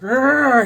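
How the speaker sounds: loud, high mock growl; mock angry growl. Dog walker mimicking the old neighbor, mock growl. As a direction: imitate a cranky neighbor's snarl. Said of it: "this guy's like".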